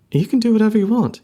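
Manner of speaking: fast, high and dismissive; breezy fox girl. Acted. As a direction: rattle it off carelessly.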